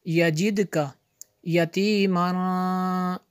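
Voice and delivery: measured, resonant teaching recitation; word-by-word recitation. Shown as a demonstration. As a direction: chant each word separately with held vowels. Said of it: "अलग-अलग लफ्ज़ को पढ़ेंगे". (एक-एक लफ़्ज़ लय में पढ़ना)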